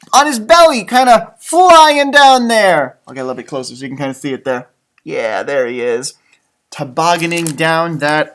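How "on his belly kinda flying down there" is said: excited. Real, not acted. Excited commentary on picture.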